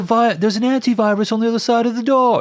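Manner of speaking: very high, loud mock British accent. Host acting the movie's antivirus argument, high British accent. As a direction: plead shrilly in a put-on British accent. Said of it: "why Mila has a British accent now".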